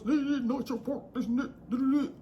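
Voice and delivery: put-on tough-talker mimicry; mock her attempt to sound hard, babbling off. Storyteller ridicules her rival trying to act hard, mock tough voice. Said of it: "trying to get in that tough voice".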